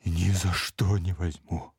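slow, lightly rasped protest; hoarse refusal. A narrator's reading. Old servant refuses the prince's money, hoarse. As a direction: croak it out slowly, refusing. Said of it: "прохрипел Иона".

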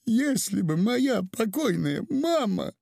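high, slow acted wail; tearful lament. A narrator's reading. Weeping mother recalls her late mama, high tearful lament. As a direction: draw it out high as if through sobs. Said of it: "сказала женщина сквозь рыдание".

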